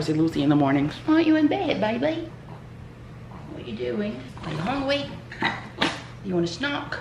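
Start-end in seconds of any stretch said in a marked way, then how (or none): 1.1-2.3 high cooing voice to a pet. Real, not acted. Talking sweetly to her pet. 3.5-6.9 soft, high pet talk; soft cooing. Real, not acted. Cooing softly to her pet.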